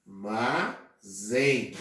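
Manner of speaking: "armazém" drawn out, the final "zém" held; slow stressed syllable. Shown as a demonstration. Teacher to class, slowly stressing "zém" in "armazém".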